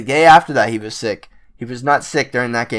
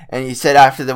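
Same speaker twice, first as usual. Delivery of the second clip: usual speaking voice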